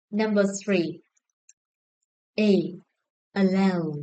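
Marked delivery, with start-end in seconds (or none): 3.3-3.9 "Allow" with the final syllable stressed; stress on second syllable. Shown as a demonstration. Teacher checking stress answers with class, second-syllable stress on "Allow". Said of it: "The second syllable is stressed".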